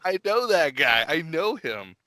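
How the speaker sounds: high-pitched mock excitement, easing down; excited recognition. Viewer spotting a friend on screen, excited. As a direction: act thrilled to spot someone you know.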